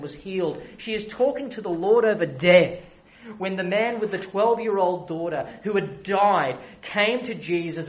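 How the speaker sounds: rising, insistent preaching cadence; building sermon. Acted. Preacher stirring his congregation, building fervor. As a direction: hammer each "She is talking" home.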